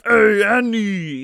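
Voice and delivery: loud, high, drawn-out shout of the name; Star Wars guy impression. Mimicking a Star Wars character calling out loud and slow. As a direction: bellow it slowly like the movie guy. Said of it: "what's his name from the Star Wars movie".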